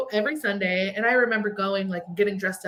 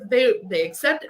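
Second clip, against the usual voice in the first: normal talking voice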